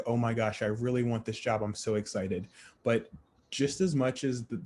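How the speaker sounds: normal talking voice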